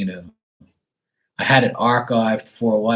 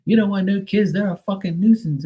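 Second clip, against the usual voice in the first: high, gushing mock voice; high mock voice praising single life; mocking envious people; 11 semitones higher than usual. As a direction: congratulate him like a jealous friend. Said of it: "now they're like".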